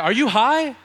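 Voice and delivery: high, loud disbelief; mock the friend's baffled question; incredulous friend. Friend reacting to the talk of strongholds, loud disbelief.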